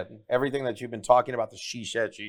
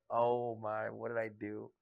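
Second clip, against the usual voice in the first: dropping to a much softer, dismayed murmur; dismayed aside; 4 semitones lower, 11 dB quieter, slower than usual. Retold or imitated. Seeing her at the door, a soft dismayed murmur. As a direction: regret it under your breath. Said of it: "I was like".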